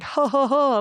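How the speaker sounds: high, hammed-up ho ho ho; jolly Santa-style laugh, mimicked; mock hearty laugh. Shown as a demonstration. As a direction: play a big belly laugh, half-mocking. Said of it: "but not like".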